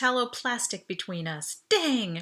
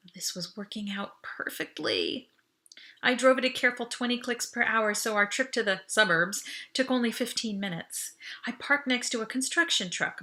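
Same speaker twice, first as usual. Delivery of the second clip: plain reading voice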